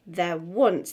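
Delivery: there ONCE, leaning on once; stress on once. Shown as a demonstration. Teacher marks the first accent: stress on once. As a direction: land the beat on once. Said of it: "that's the first strong accent".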